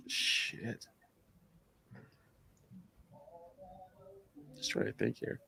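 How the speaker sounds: whispered under the breath; a real whisper, thinking aloud; muttered to self. Real, not acted. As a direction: mutter it to yourself while thinking. Drawing a blank on air.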